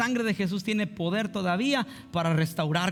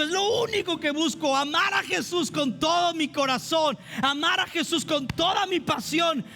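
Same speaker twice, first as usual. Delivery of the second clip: full-force preaching; 10 semitones higher than usual. Acted. Pastor urging the congregation to love Jesus, fervent preaching. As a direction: preach it out with fire, nearly shouting.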